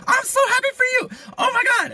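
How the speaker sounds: put-on very high, loud girlish squeal; squealing friend. Friend celebrating the news, high loud squealing. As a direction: shriek with joy for her.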